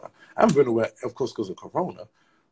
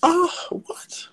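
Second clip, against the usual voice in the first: high whispered yelp of surprise; shocked yelp when the payment fails; startled cry; 3 dB louder than usual. Real, not acted. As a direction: gasp it out in shock.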